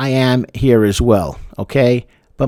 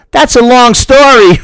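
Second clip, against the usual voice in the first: much higher and louder, slow and strange; ominous father; 11 semitones higher, 9 dB louder than usual. Retold or imitated. Father dodges the guest's question, high, loud and slow. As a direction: stretch it out in a weird, creepy dad voice. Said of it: "he looks at you and says".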